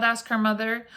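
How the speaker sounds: usual speaking voice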